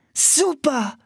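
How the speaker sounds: put-on girl delighted to feed the dogs; eager little shout; excited call. A narrator's reading. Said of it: "rief Anne".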